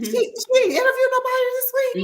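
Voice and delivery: high mock complaint; complaining viewer voice. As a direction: whine like a let-down fan. Voicing disappointed viewers.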